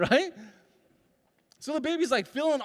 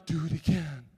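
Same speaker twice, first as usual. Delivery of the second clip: gentle encouragement; 6 semitones lower than usual. Retold or imitated. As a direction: invite the baby to try "Do it again.". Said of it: "Sets the baby down".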